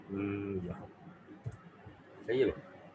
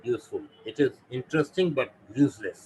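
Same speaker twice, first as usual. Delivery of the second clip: slow, louder example sentence, each word spaced; teacher slows right down for the example; slow emphatic example; 4 semitones higher, 6 dB louder, much slower than usual. Shown as a demonstration. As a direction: spell out the sentence slowly for learners. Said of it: "if I say".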